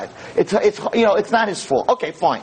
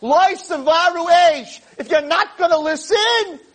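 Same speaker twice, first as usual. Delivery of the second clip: high, raised scream, slow on the verse; mock scream; 8 semitones higher, slower than usual. Hashem warning a desensitized generation, raised scream. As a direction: call it out like a divine warning. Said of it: "Hashem is screaming".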